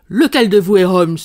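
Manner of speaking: loud, abrupt challenge from the doorway; a hulking intruder demanding loudly who Holmes is; booming demand. Acted. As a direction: bark the question at the room. Menacing giant bursts into room.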